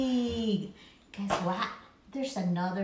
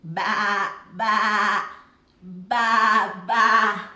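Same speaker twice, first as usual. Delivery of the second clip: louder sing-song baa; playful sheep noise; sheep bleat; 3 semitones lower, 6 dB louder, much slower than usual. Acted. Sheep sounds for child.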